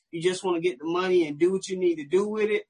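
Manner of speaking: ordinary talk, nothing marked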